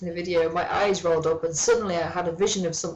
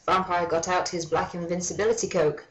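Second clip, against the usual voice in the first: ordinary talk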